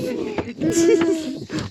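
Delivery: high giggling laugh. Real, not acted. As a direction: burst out laughing. Burst of laughter.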